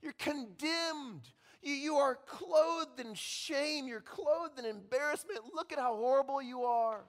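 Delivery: a devil heaping shame on you; accusing Satan, continued. Acted.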